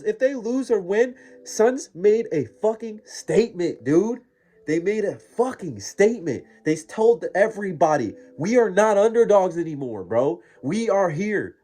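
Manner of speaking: high, loud, emphatic hype; fired-up fan rant. Real, not acted. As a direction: proclaim it proudly for your team. Fan rant defending his team.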